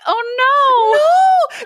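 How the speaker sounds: high gasping "oh no"; shocked, pitch jumps way up. Real, not acted. Dog put in sack.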